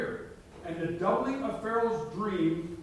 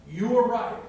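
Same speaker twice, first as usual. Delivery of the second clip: emphatic declaration; 3 semitones higher, 8 dB louder than usual. Acted. Preacher's emphatic declaration to God.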